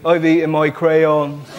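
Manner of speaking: put-on slow, dopey child voice; a slow, gormless six-year-old; child answer. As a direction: say it like a proud little kid. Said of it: "six-year-old kids".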